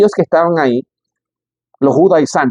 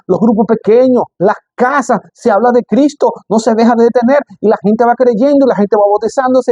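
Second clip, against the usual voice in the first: raised, ringing preacher's voice, higher pitch; fiery preaching; 6 semitones higher than usual. Acted. Pastor stirring up the church about baptisms, fervent preaching. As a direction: preach it out to the congregation.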